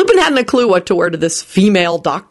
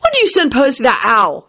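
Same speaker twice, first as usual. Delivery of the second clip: higher-pitched accusing question; demanding; 7 semitones higher than usual. A narrator's reading. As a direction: demand an explanation. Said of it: "he demanded".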